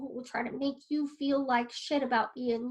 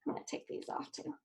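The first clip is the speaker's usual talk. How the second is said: muttered aside; 8 dB quieter than usual. Real, not acted. Aside while fixing desk.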